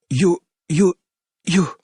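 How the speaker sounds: high, panicked stammer; a terrified young man tripping over his words; frightened stutter. A narrator's reading. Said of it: "the man stuttered".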